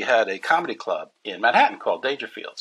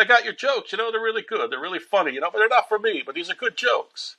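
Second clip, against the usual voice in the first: higher Rodney voice giving friendly advice; Rodney Dangerfield voice; 11 semitones higher, faster than usual. Retold or imitated. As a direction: play Rodney kindly turning down the jokes. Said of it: "He said".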